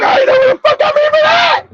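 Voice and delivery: high, fast, laughing squeal; laughing disbelief. Real, not acted. Comic recalling the secret hotel, laughing high.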